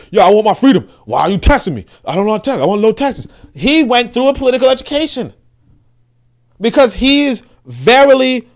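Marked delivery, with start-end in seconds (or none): 0.1-3.3 higher, louder mock indignant guy; a hammed-up protest against taxes; mocking tax-hater. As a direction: lampoon him demanding his freedom. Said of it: "he's like".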